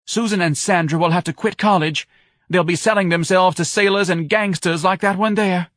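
high, loud melodramatic wail; distraught wife. A narrator's reading. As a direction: lament the family's ruin at high pitch. Wife lamenting family ruin.

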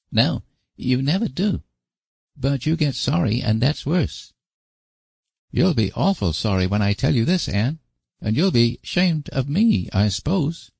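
put-on high, troubled small boy; worried little boy. A narrator's reading. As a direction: fret like a guilty child. Davy the boy confesses.

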